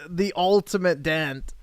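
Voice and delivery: usual speaking voice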